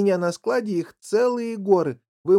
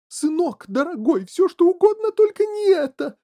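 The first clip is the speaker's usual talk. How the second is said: very high pleading cry, growing louder; alarmed wail; 13 semitones higher, 6 dB louder than usual. A narrator's reading. Mother begging her son to stop, alarmed wail. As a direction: build to a frantic high wail. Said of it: "завопила вдруг миссис Глуп".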